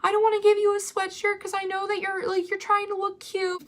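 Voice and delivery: very high, cooing imitation; mock-comfort a crying student way up high; put-on high consoling voice; soothing staffer. Imitates woman soothing crying girl.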